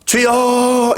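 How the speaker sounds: a short demo cry of Lord. Shown as a demonstration. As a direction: call out to God. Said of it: "어떻게 부르짖었을까요?".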